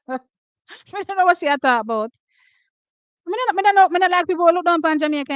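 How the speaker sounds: heated patois outburst. Real, not acted. Streamer defending Jamaica to her chat, heated and fast. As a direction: spit it out fast, offended and worked up.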